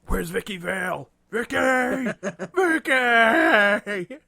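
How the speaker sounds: high, loud, drawn-out wail; deranged calling out. Acted. Crazed Batman calling through the streets, wailing shout. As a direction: holler the name desperately, stretching it out. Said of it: "wandering around the streets, like, going".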